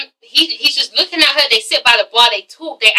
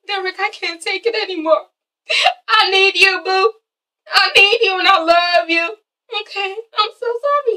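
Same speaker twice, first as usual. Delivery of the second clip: mock emotional plea; 6 semitones higher than usual. [name] begging Derek to take her back, mock pleading. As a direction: plead in a high, hammed-up lover's voice.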